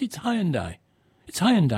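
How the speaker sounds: Hyundai as "HIGH-un-die", stressed; English Hyundai. Shown as a demonstration. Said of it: "I'm English".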